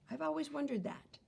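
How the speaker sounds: plain reading voice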